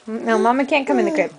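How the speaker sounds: usual speaking voice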